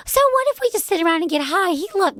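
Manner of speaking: high-pitched mock Jersey Shore girl; Jersey Shore accent. Jersey girl argues with crying friends, mock Jersey Shore accent. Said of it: "Do a Jersey Shore".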